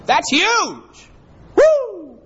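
high, loud joyful shout; exultant whoop. Real, not acted. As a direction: celebrate it out loud. Preacher exults.

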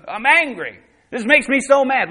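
high, loud mock-angry outburst; mock anger. Acting out contentious believer.

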